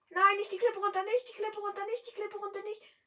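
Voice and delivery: high, loud panicked screaming; panicked yell. Real, not acted. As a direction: scream for it not to go off the cliff. Car heading for the cliff. (panisch, hoch und laut schreiend)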